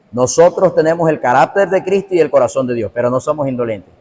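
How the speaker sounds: usual speaking voice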